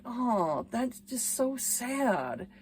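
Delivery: soft, slow retold sympathy; sorry, sympathetic. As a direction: let it sink gently with pity. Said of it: "I felt so sorry for the character".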